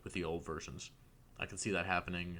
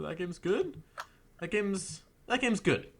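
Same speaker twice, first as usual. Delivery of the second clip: rising, more emphatic each time; excited; 10 semitones higher, slower than usual. Real, not acted. Repeats praise, pitch climbing.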